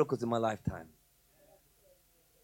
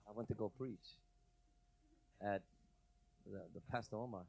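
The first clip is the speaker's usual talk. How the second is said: very soft, low reluctant answer; small, hesitant, guilty reply; sheepish confession; 8 semitones lower, 17 dB quieter than usual. Retold or imitated. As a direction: admit it quietly and haltingly, hoping it passes unnoticed. Sheepish answer to questioning leader.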